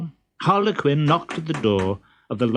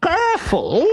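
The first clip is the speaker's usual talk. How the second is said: very high, pompous suitor voice; silly pompous voice. Acted. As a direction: play a vain fool, high and squeaky.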